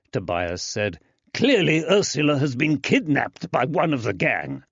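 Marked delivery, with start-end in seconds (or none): 1.3-4.6 indignant. Acted. Tobias insists, enraged.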